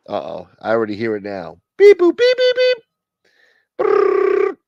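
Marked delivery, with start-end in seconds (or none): 1.8-2.8 squeaky electronic beeping; high robot beeps. Retold or imitated. 3.8-4.6 buzzing noise. Retold or imitated. Imitated noise.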